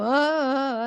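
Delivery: loud, drawn-out mock groan; exaggerated "oh" of protest; imitated groan. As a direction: groan like someone hearing it too often. Listeners groan at her mantra.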